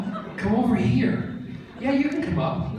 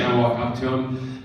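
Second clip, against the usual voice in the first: ordinary talk, nothing marked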